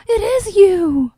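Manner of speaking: high, drawn-out gasp of recognition; mock amazed wife. Wife recognizing her husband, hammed-up amazement. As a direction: act out a slow, loud realization.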